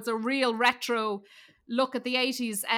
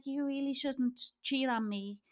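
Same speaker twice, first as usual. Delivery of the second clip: softer, limp complaint with no bite; feeble scolding; 8 dB quieter than usual. Retold or imitated. Melanie's character scolding the cheating boyfriend, feeble soft imitation. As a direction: scold him as gently as possible. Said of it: "she was too soft in it".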